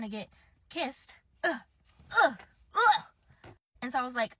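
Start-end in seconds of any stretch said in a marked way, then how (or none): 2.7-3.0 disgusted groan. Real, not acted.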